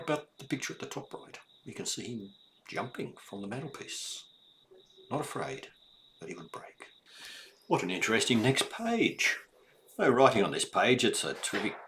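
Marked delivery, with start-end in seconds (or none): 5.1-6.9 hushed, breathless whisper. A narrator's reading. Reading to a child, whispering the scary bit. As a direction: drop to a secret whisper.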